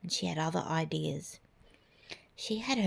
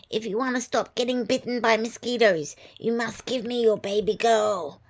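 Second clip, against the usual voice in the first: high, loud screeching witch; 3 semitones higher, 5 dB louder than usual. Acted. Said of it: "the witch screeched".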